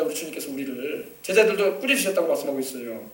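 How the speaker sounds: normal talking voice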